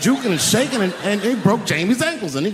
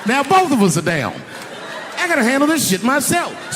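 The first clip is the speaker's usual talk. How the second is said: mock panic; 7 semitones higher than usual. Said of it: "I said".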